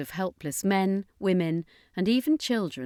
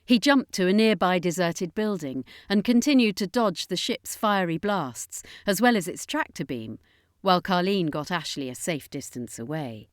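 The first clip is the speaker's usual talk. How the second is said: ordinary delivery, nothing marked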